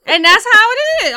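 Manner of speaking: loud, high and final; loud emphatic. Real, not acted. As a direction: declare it like a verdict. Emphatic playful declaration.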